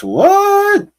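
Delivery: loud, very high-pitched disbelief; astonished high yelp; incredulous outburst. Real, not acted. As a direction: shoot way up high in loud surprise. Surprised at being told sounds French.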